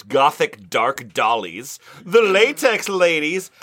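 ordinary talk, nothing marked

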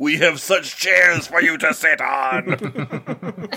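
slow, eerie horror villain voice; Pinhead impression. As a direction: do a menacing Cenobite drawl. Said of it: "a Pinhead creation".

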